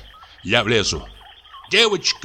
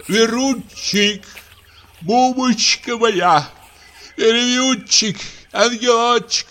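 slow, higher sweet wheedling; coaxing; 7 semitones higher than usual. Acted. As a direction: coax her sweetly, drawn out. Coaxing wife at window.

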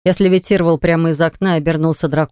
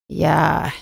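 put-on old man voice; slower than usual. Oracle admits guilt.

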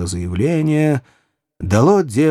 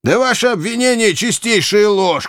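high, indignant outburst; enraged exclamation; 9 semitones higher than usual. A narrator's reading. Lesli rejecting the provost's charge, enraged. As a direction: throw the accusation back, furious and high. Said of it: "воскликнул взбешённый Лесли".

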